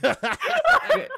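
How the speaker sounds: high squeal through laughter; laughing squeal. Real, not acted.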